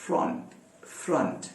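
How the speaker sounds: soft फ्रंट with the u of cut; model word. Shown as a demonstration. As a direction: say फ्रंट quietly with a short uh. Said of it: "F R O N T".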